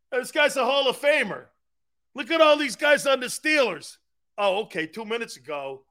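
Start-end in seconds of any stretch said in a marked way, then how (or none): 0.1-4.0 high, excited bragging; retold fan raving. Grandfather now boasting about the Steelers, excited raving. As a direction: hype them up like a converted fan. Replays grandfather's sudden fandom.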